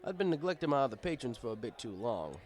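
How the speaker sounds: ordinary delivery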